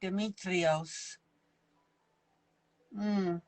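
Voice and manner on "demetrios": put-on slow Greek accent, rolled out. Says the name again for fun in a Greek accent. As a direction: let the name roll off the tongue in a Greek accent. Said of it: "Just rolls off the tongue".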